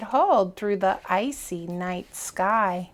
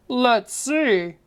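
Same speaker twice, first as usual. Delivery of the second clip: mumbled; 3 semitones higher than usual. Acted.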